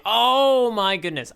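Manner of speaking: stretched-out delighted surprise; real surprise. Real, not acted. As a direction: gasp at the panel, drawn out. Reacting to shown panel.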